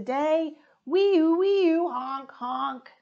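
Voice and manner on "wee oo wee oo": high, loud siren wee-oo; loud siren wail. Acted. Little truck racing out, loud siren. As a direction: blare it like a siren.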